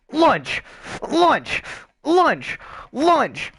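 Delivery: repeated high yelled Lunge!; mock battle yells. Troll spamming lunge attack.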